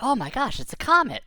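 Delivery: high, loud gasp of wonder; excited surprise. Retold or imitated. Kid spotting a falling comet, high and amazed. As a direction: sees a comet fall. Narrator voicing own surprise.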